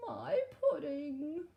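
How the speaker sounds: high, slow wail over lost pudding; a storybook king wailing over his pudding; king crying out. Acted. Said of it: "cried the king".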